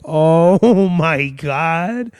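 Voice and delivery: hugely stretched-out mock despair; long, suffering groan of the words; exasperated groan. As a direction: drag it out in comic agony. Acted exasperation at delayed show.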